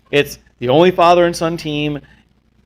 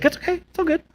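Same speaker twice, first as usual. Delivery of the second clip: high, softer quote of her; breezy imitated reassurance; 13 semitones higher, 8 dB quieter, faster than usual. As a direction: mimic her easygoing okay, pitched up and light. Retelling her easygoing reply.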